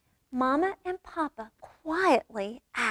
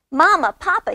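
high, loud call for parents; little cub yelling; 6 semitones higher, 6 dB louder than usual. A narrator's reading. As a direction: pipe up loudly to join in. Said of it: "yells Honey".

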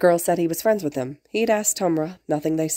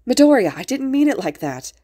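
high, louder, urgent protest; shouted protest; 7 semitones higher, 5 dB louder than usual. A narrator's reading. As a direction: call out in dismay. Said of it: "boy in glasses had shouted".

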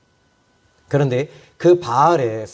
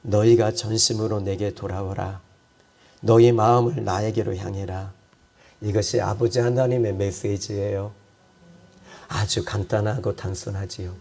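much lower and softer, intimate; gentle exhortation; 8 semitones lower, 5 dB quieter than usual. Acted. Pastor gently addressing beloved saints, soft and low. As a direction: bring it down to a quiet, close appeal.

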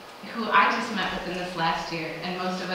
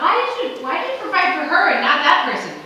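high, loud demanding "why"; indignant why; 8 semitones higher, 7 dB louder than usual. Retold or imitated. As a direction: throw the question up at God, outraged.

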